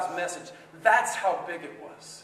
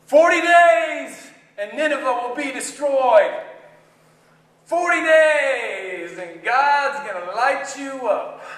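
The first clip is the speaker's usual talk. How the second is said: proclaiming doom; 3 semitones higher, 4 dB louder than usual. Acted. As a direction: call out the warning to the city.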